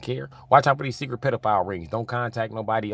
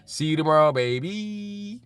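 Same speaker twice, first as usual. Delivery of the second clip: put-on "baby" lifted very high on a rising tune; hammed-up sweet goodbye; sing-song goodbye; 8 semitones higher, slower than usual. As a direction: coo "baby" up high, more than before. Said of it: "I kinda put baby in there".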